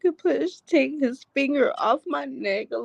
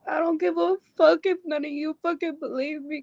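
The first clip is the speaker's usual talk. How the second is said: ordinary talk, nothing marked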